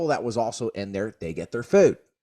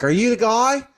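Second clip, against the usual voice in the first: impatient demand; 13 semitones higher, 8 dB louder than usual. Retold or imitated. Wendy's staff asking about pickup.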